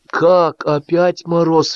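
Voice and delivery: high, drawn-out baffled exclamation; startled cry. A narrator's reading. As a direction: cry out in disbelief. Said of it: "вскрикнул Шашков".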